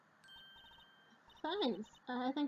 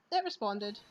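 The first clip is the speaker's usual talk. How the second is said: ordinary delivery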